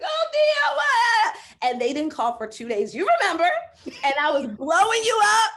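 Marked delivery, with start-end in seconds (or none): 0.0-1.4 loud, high shriek; frantic call to her coach; panicked shout. Retold or imitated. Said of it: "I was like". 2.9-5.5 laughing. Real, not acted. Laughing retelling of panic.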